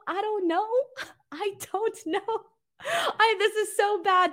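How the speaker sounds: high, helpless giggly fluster; embarrassed laughing. Real, not acted. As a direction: laugh helplessly while giving up. Flustered by crude translation attempt.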